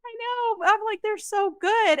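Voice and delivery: high pleading excuse to the family; pleading whine. Retold or imitated. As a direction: whine it like a child defending a treat. Defending sad books, pleading.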